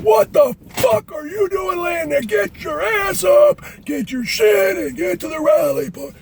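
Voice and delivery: high, loud chewing-out; drill sergeant shouting. Retold or imitated. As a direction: yell orders in stretched-out bursts. Sergeant chews out the trainee.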